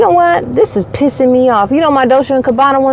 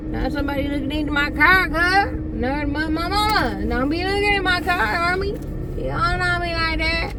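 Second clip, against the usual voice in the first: mock tough guy; 4 semitones higher, 9 dB quieter than usual. Mock tough street persona.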